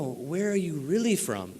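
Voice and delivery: a hushed, pointed mock question from the news anchor; lightly voiced anchor, quietly insisting; quiet retold question. News anchor pressing him on his origins, hushed and pointed. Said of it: "And she goes".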